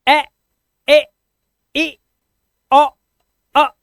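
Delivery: ए, ए, ई, ओ, अ as five short sounds: ae, eh, ih, o, uh; short vowel drill. Shown as a demonstration. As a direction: punch out each of ए, ए, ई, ओ, अ alone, short, with a pause between. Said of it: "ये इनके sounds हैं". (ए, ए, ई, ओ, अ की छोटी ध्वनियाँ, रुक-रुक कर)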